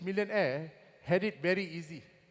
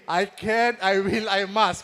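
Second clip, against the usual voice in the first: loud, proud chant of the mantra; mantra shout; 8 dB louder, slower than usual. Retold or imitated. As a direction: belt it like a little warrior. Son reciting the mantra.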